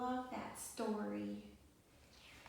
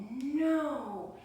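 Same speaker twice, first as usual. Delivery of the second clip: ordinary delivery, nothing marked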